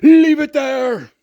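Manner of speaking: high, drawn-out yell, mimicking the shopkeeper; imitated yelling. Shopkeeper yelling at a customer one foot in the door, imitated yell. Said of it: "to a guy in a turban yelling".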